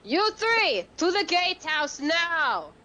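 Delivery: yelled order. Acted. Officer figure sending three soldiers to shelter, yelled command. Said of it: "she's gonna yell to them".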